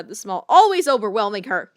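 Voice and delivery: plain reading voice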